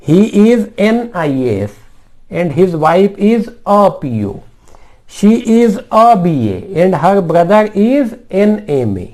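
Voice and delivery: slow, word by word example read; slow dictation. Shown as a demonstration. Teacher reading an example sentence to students, slowly.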